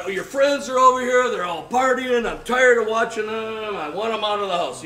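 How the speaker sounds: a wife yelling down the phone; mock angry wife. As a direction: rant like a fed-up wife.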